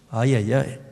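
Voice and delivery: ordinary delivery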